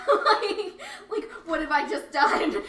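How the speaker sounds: laughing while talking; giggling through the words. Real, not acted. Laughing at own mishap.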